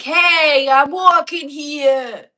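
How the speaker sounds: loud, high, drawn-out New York shout; New York accent. Shown as a demonstration. Demonstrating New York for viewers, shouted New York accent. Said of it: "Isn't that New York?".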